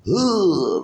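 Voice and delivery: high, loud imitated whoosh; sudden high-pitched action sound effect; loud swing noise. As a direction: voice the blow as it flies. Imitating his swing at the neck.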